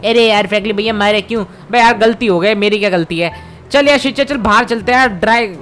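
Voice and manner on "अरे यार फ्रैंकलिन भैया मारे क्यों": lightly voiced cartoon kid, aggrieved; a mildly whiny little boy asking why; Shinchan voice. Shinchan hurt.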